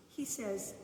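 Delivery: ordinary delivery, nothing marked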